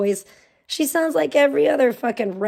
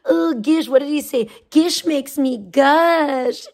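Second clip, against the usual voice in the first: higher, louder, drawn-out lovesick gush; mock swooning fan; 5 semitones higher, 5 dB louder, slower than usual. Streamer mocking a fan's crush, higher swooning drawl. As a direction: ham up a smitten admirer savoring gush. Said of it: "Sandman is like".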